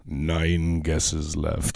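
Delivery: low, very slow and smug; smug salesman. Retold or imitated. Mocking the salesman's guessing game, low and slow. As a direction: drag it out like a smug tease. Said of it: "What a fucking dickhead".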